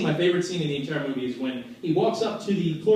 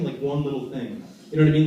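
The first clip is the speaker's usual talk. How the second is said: ordinary delivery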